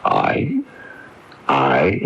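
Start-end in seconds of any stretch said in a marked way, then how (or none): 0.0-0.6 one sharp, very high "I?" of offended disbelief; indignant surprise. Acted. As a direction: toss it back, affronted at the accusation. Accused of causing this.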